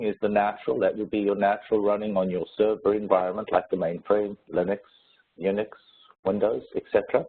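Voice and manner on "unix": ordinary talk, nothing marked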